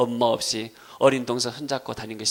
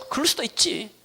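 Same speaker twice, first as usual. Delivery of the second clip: accepting; 10 semitones higher than usual. Acted. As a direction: brush it off gently, with understanding. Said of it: "믿음으로 하는 거예요".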